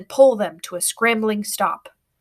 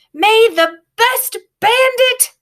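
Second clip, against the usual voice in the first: high, slow, called-out taunt; bandit girl calling out; 12 semitones higher, 5 dB louder, slower than usual. A narrator's reading. Girl taunts the boy with the rat, high and calling. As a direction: sing it out to the boy behind her. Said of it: "giving a triumphant whoop".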